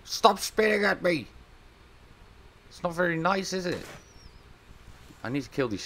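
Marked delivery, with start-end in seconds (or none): 0.0-3.8 high, mock-offended telling off; high scolding. Real, not acted. Gamer scolding the spitting creature, high mock-offended.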